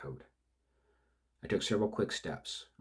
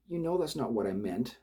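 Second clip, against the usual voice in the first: anxious protest; 7 semitones higher, 8 dB louder than usual. Acted. Accomplice protests.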